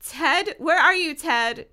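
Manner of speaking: crying out. A narrator's reading. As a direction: call out for a missing friend. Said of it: "Tracy cried".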